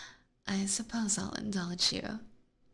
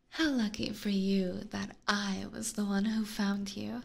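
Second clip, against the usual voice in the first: usual speaking voice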